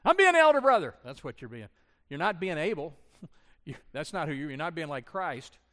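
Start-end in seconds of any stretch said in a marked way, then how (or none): 0.0-1.0 very high, loud sulky wail; mock whiny protest. Pastor mocks a congregant's sulky protest, loud and high. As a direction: whine it like a stung big brother. Mocking the listener's reply.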